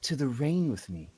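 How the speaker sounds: soft, eerie lady voice; conspiratorial woman. Acted. Woman inviting Toothless to hear the rain, soft conspiratorial voice. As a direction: invite them gently into a strange secret. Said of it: "leans in conspiratorially and she says".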